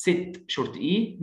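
ordinary talk

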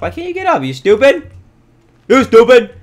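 high, loud mock outrage; exasperated yelling. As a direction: scold a useless animal, building to a yell. Scolds animal that will not climb.

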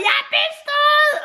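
high, jubilant retold yell; re-enacted shout. Student re-enacts telling the driving instructor, jubilant shout. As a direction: act out yelling the good news. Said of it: "jeg råbte bare".

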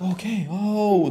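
high, drawn-out dawning "oh"; slowly light up with discovery; acted realization. Acting out finding one's own voice, slow wonder.

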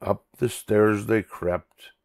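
ordinary delivery

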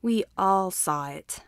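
slow, firm teacher voice; calm authority that settles the room. A narrator's reading. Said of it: "Aizawa said firmly".

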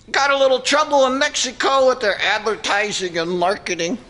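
usual speaking voice

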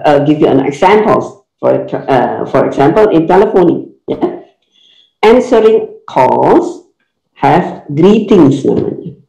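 usual speaking voice

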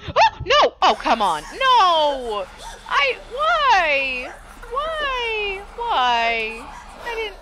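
shrieking high and loud; panicked screaming. Real, not acted. Ghost attacks her in game.

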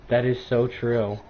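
flat, low monotone. Shown as a demonstration. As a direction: say it dead flat like a robot. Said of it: "in the most monotone voice".

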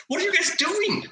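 high, indignant squeaky voice; the squadron commander in a mocking high squeak. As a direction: play the offended officer going high. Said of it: "He's like".

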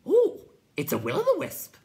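loud, very high hooting creature; excited creature. A narrator's reading. Night Hob spots the Will-o'-the-Wisp, high hoot. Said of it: "whispered the Night Hob".

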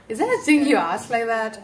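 loud, taken-aback question; can you believe they asked that; indignant disbelief. Real, not acted. Reacting to the interviewer's question, loud and indignant.

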